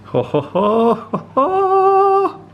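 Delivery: rising Santa-style ho ho ho; hearty belly ho ho ho, climbing high; jolly ho ho ho. Pretend. Looking at the big platter.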